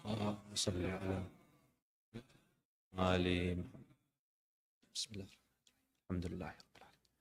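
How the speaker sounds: very soft, low, rapid recitation; murmured prayer. Real, not acted. Preacher recites Fatiha for departed ones, murmured prayer. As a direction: recite under the breath, nearly inaudible.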